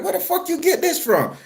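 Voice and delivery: high, alarmed disbelief. Retold or imitated. Brother spotting the meat cleaver, high alarmed question. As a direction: shoot it up high at the sight of the blade.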